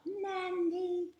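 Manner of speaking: high, held calling cry; shouted echo. A narrator's reading. Eloise copying her tutor's shout, high drawn-out call. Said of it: "And I shout".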